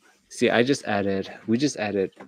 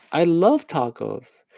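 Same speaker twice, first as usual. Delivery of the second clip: slow, high, drawn-out enthusiasm; mock gushing; 7 semitones higher, slower than usual. Signer fed a line about tacos, mock gushing. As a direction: gush it slowly in a higher pitch.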